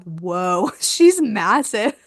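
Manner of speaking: slow, laughing amazement; amazed laughing. Retold or imitated. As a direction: draw it out through a laugh. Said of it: "I looked down at her".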